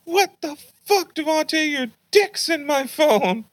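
very high mock-mom voice; outraged mom. Mom confronting son about her phone, high outraged imitation. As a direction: imitate an appalled mother in falsetto.

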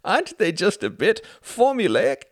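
high, loud amused teasing; laughing. Acted. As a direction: poke fun while laughing. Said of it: "He laughed again, harder".

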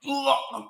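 mock retching groan; retching sound. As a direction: imitate throwing up.

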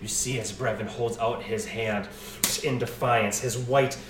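loud, slow rising battle narration; epic narration. Acted. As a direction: narrate the clash with intensity.

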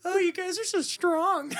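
high, breathy mock admiration; mock swooning girl. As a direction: coo it like a starstruck fan. Mocking girls swooning over men.

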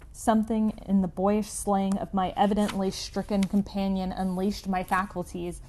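usual speaking voice

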